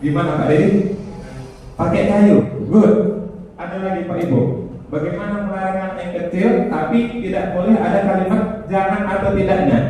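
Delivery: ordinary talk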